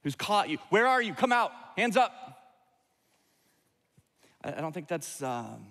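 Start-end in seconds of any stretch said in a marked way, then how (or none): 0.7-1.1 a police officer demanding, higher and louder; stern police officer. Retold or imitated. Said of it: "a police officer". 1.1-2.1 a police officer demanding, higher and louder; stern police officer. Retold or imitated. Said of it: "a police officer".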